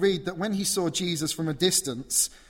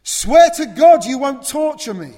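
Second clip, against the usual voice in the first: screamed much higher and louder; crying out; 11 semitones higher, 19 dB louder than usual. Acted. Possessed man screaming his plea to Jesus at full force. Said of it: "he cries it out".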